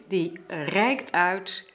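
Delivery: ordinary delivery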